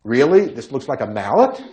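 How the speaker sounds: skeptical question with rising end; incredulous. Real, not acted. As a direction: question it in mild disbelief. Doubts the definition he looked up.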